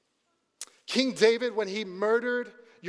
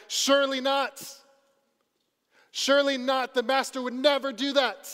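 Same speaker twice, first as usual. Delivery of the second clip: higher and insistent, pushing back hard; emphatic denial; 5 semitones higher, 3 dB louder than usual. Retold or imitated. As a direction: protest it like someone who refuses to believe. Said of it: "with an emphatic".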